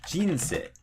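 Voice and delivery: jinsei high on jin, then dropping on sei; jinsei, fall right after the first syllable; head-high jinsei; pitch drop after first syllable. Shown as a demonstration. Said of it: "maybe it drops right after the first syllable".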